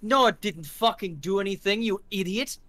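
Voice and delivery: exasperated thug. Acted. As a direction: scold your dumb buddy. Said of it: "He's like".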